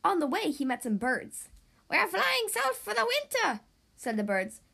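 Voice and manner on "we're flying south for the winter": high, light little-bird voice, much higher; chirpy bird voice. Acted. Birds tell the detective where they fly, high bird voice. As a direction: pitch it up like a small bird. Birds talk to Big Macs.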